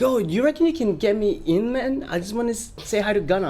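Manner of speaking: high-pitched imitation of a hopeful fan; pleading stranger. Fan asking crew member to get him in, pleading high. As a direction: imitate someone angling for a favour, voice up.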